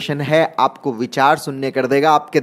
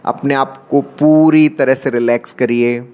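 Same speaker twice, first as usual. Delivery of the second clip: guided meditation. Acted. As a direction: draw out key words to pace each breath. Leads guided meditation breathing round. (धीमे, शब्दों को खींचते हुए ध्यान का निर्देश)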